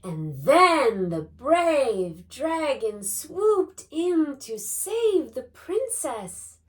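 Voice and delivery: loud, slow, high read-aloud; booming. Acted. Boy reading aloud so a dragon hears, booming. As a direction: boom it out, stretching each word. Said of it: "read aloud in a booming voice".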